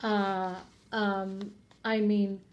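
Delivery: halting, low stammer with pauses; sheepish hemming and hawing; hesitant fumbling. A narrator's reading. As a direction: stall awkwardly for an answer. Father caught out, stammering for answer.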